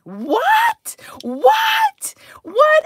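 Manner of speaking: high, breathless shouted whats; stunned what. Retold or imitated. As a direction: squeak out the whats in total shock. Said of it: "I was just like".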